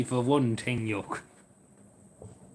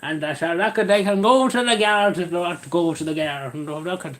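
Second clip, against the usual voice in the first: hammed-up, raised Cavan brogue; put-on Cavan accent; 2 semitones higher than usual. Customer refusing over five euro, put-on Cavan accent. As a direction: play a stubborn customer in thick Cavan. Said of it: "I don't know what kind of accent that was".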